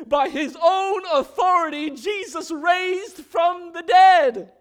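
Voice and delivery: loud triumphant sermon voice; preaching at full force. Acted. Preacher proclaiming that Jesus rose from the dead: loud, triumphant. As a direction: ring out the resurrection.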